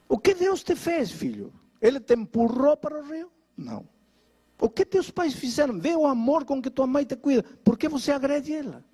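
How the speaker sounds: pitched high, pressing rhetorical questions; stern rebuke. Retold or imitated. Pastor confronts the bed-bound son, pitched high and pressing. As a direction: confront him hard, question after question.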